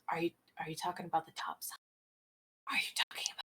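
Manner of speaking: hurried, secretive whisper; fast whisper. Acted. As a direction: whisper fast, afraid of being overheard. NPC afraid to speak of topside.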